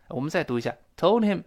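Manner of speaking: ordinary talk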